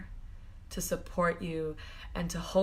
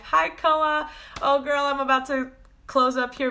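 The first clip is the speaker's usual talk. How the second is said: a higher, louder hello to a viewer; excited greeting; 5 semitones higher, 6 dB louder than usual. Real, not acted. Greeting a viewer named Koa who just joined, excited.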